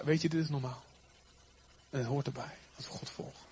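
laag, zacht geruststellend; rust brengen. Acted. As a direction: stel iemand in paniek zachtjes gerust. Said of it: "gewoon rust brengen". (kalm en steeds zachter sussend)

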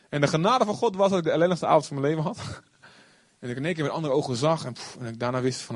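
normal talking voice

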